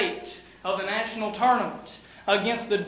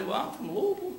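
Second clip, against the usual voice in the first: soft, lilting put-on voice; hushed imitation with a swooping tune; mock Louisville local; 8 dB quieter, faster than usual. Preacher mimics Louisville folk saying where they're from, in a mock soft voice.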